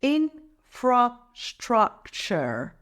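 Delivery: very slow "Infrastructure", a gap after each syllable; broken into syllables. Shown as a demonstration. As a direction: take "Infrastructure" apart syllable by syllable. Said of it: "Let's break it down".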